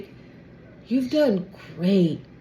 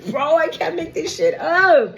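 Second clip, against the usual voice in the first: laughing, higher and louder, can't believe it; laughing disbelief; 6 semitones higher, 4 dB louder than usual. Real, not acted. Reader laughing at what the cards show. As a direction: crack up at the cards.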